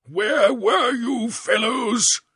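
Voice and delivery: high, slow, angry shout; towering passion, shouting; shouting prince. A narrator's reading. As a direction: bark it at the deserters. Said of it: "shouted he".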